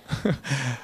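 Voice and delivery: a run of amused laughter; real laughter; laughing. Real, not acted. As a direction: laugh off the audience's guess. Laughing at audience guesses.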